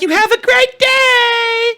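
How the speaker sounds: very high, slow, sugary sweet; sugary lady voice. Retold or imitated. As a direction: talk down kindly to a child. Lady's sugary goodbye.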